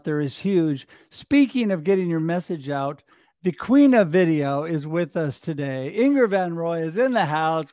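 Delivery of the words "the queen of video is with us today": normal talking voice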